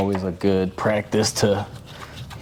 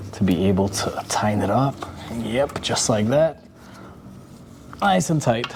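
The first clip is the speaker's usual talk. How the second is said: a bit out of breath; breathing heavy. Real, not acted. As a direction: talk through heavy breathing. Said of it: "I'm breathing heavy in this video".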